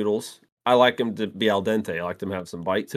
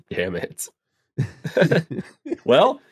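normal talking voice

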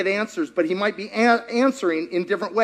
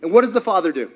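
ordinary delivery

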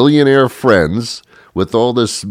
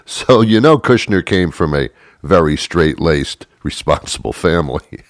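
usual speaking voice

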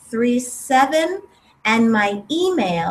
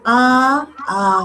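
exhaled ah. Shown as a demonstration. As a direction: open ah sound on the out-breath. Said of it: "Exhale, saying".

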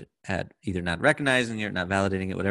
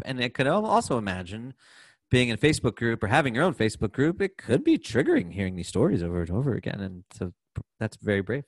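ordinary delivery

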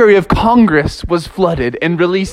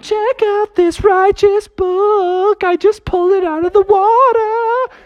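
much higher, slow, stretched dude voice; surfer dude Justin; slower than usual. Retold or imitated. Justin shows off the book he pulled out, high and stretched. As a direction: drawl it like a thrilled surfer kid. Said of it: "Justin was like".

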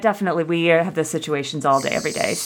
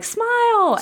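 encouraging call. Retold or imitated. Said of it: "and everyone's like".

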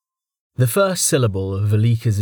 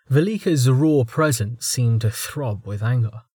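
plain reading voice

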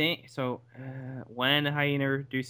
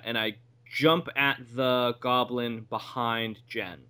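normal talking voice

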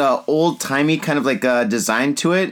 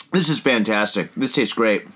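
ordinary talk, nothing marked

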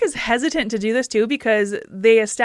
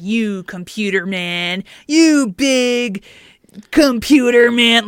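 slow jeer getting louder; mock taunt; 5 dB louder, slower than usual. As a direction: taunt him, rising to a louder jab. Kirk taunting Spock.

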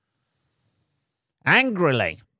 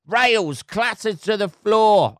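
slow, higher, louder newsreader drama; mock dramatic reading; 6 semitones higher, 4 dB louder, slower than usual. Shown as a demonstration. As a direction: read it like a gripping thriller. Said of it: "dramatise it".

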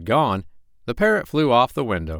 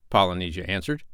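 ordinary delivery

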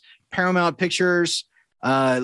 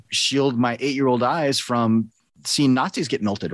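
ordinary talk, nothing marked

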